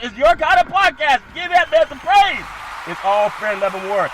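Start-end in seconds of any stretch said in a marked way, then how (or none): 0.0-2.5 big promotional shout; shouted hype. Real, not acted. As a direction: sell it with a hollered cheer. Shouted podcast plug and catchphrase.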